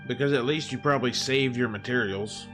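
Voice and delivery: ordinary delivery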